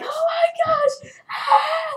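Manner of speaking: high, loud, drawn-out mock scream; hammed-up screaming wail; retold scream. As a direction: shriek it as if being dragged off. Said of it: "screaming, and I'm crying".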